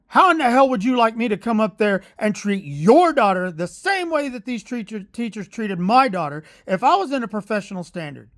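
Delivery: mild retold yell. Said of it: "And I was yelling".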